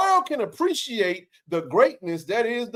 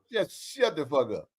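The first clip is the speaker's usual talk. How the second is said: laughing; 7 semitones higher, 3 dB quieter than usual. Real, not acted. Laughing at haters.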